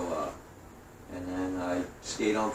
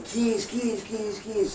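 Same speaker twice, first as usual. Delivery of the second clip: warning call; 13 semitones higher, much slower than usual. Retold or imitated. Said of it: "And they're saying".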